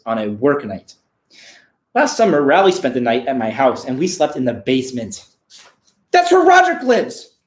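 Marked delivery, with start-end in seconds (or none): 6.1-7.4 much higher and louder, thrilled; excited outburst. Real, not acted. Reader exclaiming to viewers about the basement, excited. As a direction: blurt it out, delighted. Excited aside while reading.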